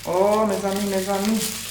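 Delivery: soft exclamation in Haitian French. Real, not acted. Vlogger marvelling at the box, Haitian exclamation. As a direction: let the Haitian come out, softly. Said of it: "The Haitian in me is coming out".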